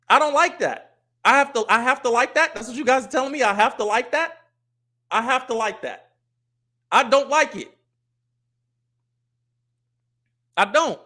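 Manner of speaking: high, loud incredulous objection; exasperated protest. Real, not acted. Streamer telling viewers he won't be made to like it, exasperated. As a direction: push back hard, voice jumping up.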